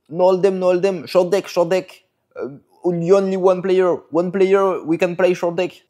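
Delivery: high-pitched imitation of Chinese-accented broken English; mock Chinese accent. Nabo urging the narrator to play short deck, mock Chinese accent. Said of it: "c'est vraiment ça son accent".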